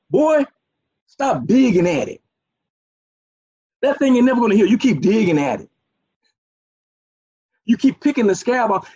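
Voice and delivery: a lightly voiced old elder scolding a child; old folk scolding. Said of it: "This is what the old folk used to say".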